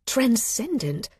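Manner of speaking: ordinary delivery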